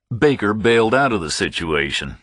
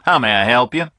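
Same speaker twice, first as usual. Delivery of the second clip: put-on higher, louder Southern American accent, sure of himself; Southern accent, answering the phone with confidence; a confident Southern caller picking up the phone; confident southern man; 7 semitones higher, 5 dB louder, faster than usual. A narrator's reading. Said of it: "A southern accent, a confident manner".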